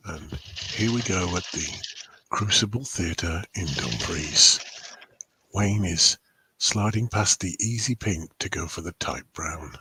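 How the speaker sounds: low, soft snooker commentator; hushed Crucible commentary; snooker announcer. Pretend. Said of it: "It's snooker announcers as well".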